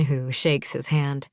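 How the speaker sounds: ordinary delivery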